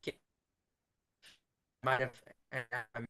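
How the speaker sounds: choppy broken-up call audio; breaking up. Real, not acted. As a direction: voice cutting in and out on a bad line. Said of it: "you're breaking up quite a bit".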